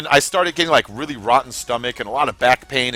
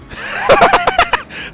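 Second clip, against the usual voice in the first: high burst of laughter; 5 dB louder than usual. Real, not acted. Laughing at the joke.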